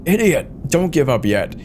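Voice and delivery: high, loud snap that settles into orders; captain barking at his co-pilot; angry yell. Acted. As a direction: yell at him to keep fighting.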